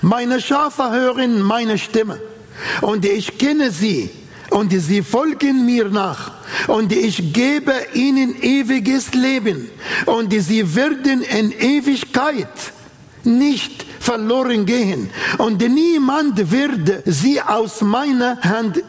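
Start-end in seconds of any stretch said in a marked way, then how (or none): none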